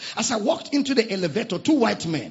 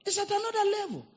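high fast echo; 5 dB quieter, faster than usual. Acted. Congregation-style repeat.

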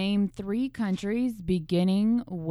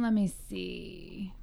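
usual speaking voice

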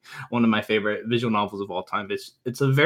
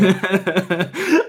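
laugh; 6 semitones higher than usual. Real, not acted. Laughs at fight remark.

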